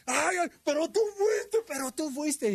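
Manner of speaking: very high, drawn-out wail; mock pained accusation. Injured player to the teller, mock wailing accusation. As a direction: imitate a hurt player accusing.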